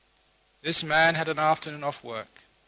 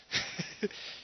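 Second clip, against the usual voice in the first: airy real laugh; 7 dB quieter than usual. Real, not acted. Teller laughs at his joke.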